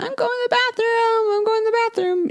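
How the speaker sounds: put-on high, proud toddler announcement; little girl voice. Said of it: "made a big announcement".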